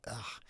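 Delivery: quiet groan of disgust at his own recording; disgusted ugh. Retold or imitated. Coach mocking his own recording with a soft disgusted groan. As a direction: groan like you hate hearing yourself. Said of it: "When I listen to myself back".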